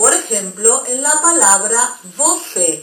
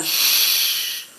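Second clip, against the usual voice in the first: soft unvoiced sh; a quiet hushing sh; shushing sound; 5 dB quieter than usual. Shown as a demonstration. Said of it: "como una Y nuestra".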